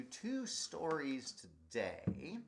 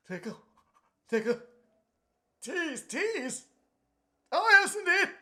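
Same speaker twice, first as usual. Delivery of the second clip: higher and louder as the sneeze builds; rising sneeze build-up; 10 dB louder than usual. Acted. Performer to children, building up to a big sneeze. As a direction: climb toward the sneeze, straining more each word.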